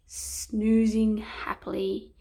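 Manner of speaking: ordinary delivery, nothing marked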